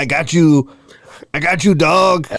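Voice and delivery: hammed-up Cheech from Cheech and Chong accent. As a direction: play it up like Cheech. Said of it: "you have a new accent, and it is Cheech".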